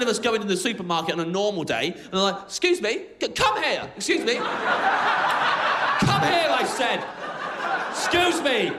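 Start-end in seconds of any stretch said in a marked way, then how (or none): 2.4-8.7 high, insistent summons to shop staff; pushy high-pitched hailing; imperious calling out. Acted. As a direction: call them over like an impatient, entitled customer. Acts rude shopper summoning supermarket staff.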